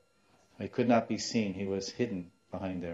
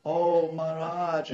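high, drawn-out mock wail; long, raised cry of appeal, imitated; imitated tearful wail; 7 semitones higher, 5 dB louder, slower than usual. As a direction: wail out the plea like a distraught mother, acted. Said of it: "and she starts crying".

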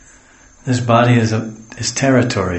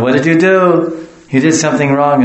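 high, loud telling-off of a dog; playfully exaggerated dog-owner reprimand; mock scolding; 5 semitones higher, 6 dB louder than usual. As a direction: draw out an accusing question, pitched up.

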